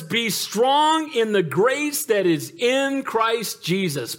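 very slow, each word drawn out; stretched-out scripture line; slow emphatic. Acted. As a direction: lean on every word of the verse. Preacher drawing out key verse.

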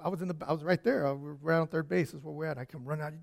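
usual speaking voice

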